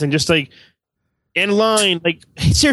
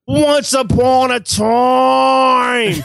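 very slow, higher, booming mock Australian; Australian storyteller imitation; 6 semitones higher, much slower than usual. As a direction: stretch each word like a hammed-up Australian storyteller. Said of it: "He would say".

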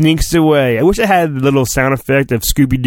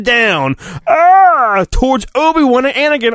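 dramatic crash; 8 semitones higher than usual. Acted. Reading to the listener as the column falls, in an excited rush.

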